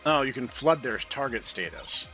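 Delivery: normal talking voice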